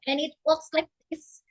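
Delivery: ordinary delivery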